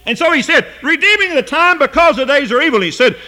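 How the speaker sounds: fervent sermon delivery; impassioned preaching. Real, not acted. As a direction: preach it out, stretching the key words high. Preaches at full force.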